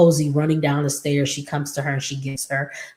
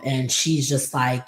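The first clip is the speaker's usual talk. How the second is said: ordinary delivery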